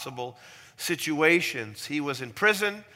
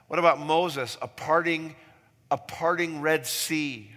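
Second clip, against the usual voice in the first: normal talking voice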